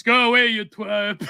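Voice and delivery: lightly voiced old grouch brushing someone off; a mock-grumpy dismissal of a pest; imitating Kissinger. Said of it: "and he goes".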